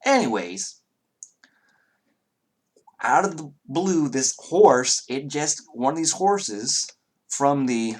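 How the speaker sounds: reluctant Southern accent, hammed up for the table. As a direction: play it up as a friendly Southern stable hand. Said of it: "abhor the southern accent".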